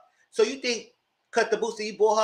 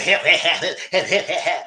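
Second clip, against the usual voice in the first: mocking him while laughing; laughing mockery; 5 dB louder than usual. Vlogger mimicking Carlos laughing, repeating him.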